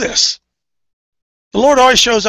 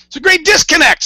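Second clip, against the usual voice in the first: emphatic shout; 9 semitones higher, 4 dB louder than usual. Real, not acted. Repeating point loudly for hall.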